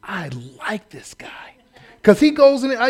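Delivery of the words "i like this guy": whisper aside. Real, not acted. Aside to congregation.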